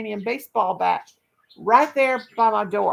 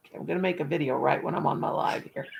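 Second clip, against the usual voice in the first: ordinary talk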